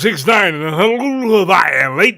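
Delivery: booming late-night radio announcer. Acted. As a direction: hype it like a rock DJ. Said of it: "radio personality in Hawaii".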